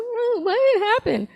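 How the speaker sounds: high, slow, sugary feigned innocence; fake innocent. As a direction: play dumb in a sweet high voice. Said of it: "I'm like".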